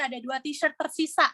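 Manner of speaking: ordinary delivery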